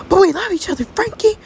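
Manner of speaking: high, desperate plea; pleading. Acted. Martha begs Frankie to stay, high pleading. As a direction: beg him not to leave.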